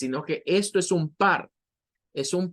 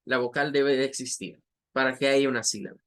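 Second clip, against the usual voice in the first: ordinary talk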